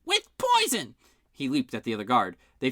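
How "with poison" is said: very high loud shriek; screaming. Acted. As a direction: shriek the accusation. Guard screams accusation.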